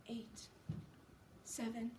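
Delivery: very soft, faint counting; low murmur. Acted. Sick Johnsy counting backward in bed, faint and low. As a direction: count softly, with long gaps. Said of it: "She heard a low sound".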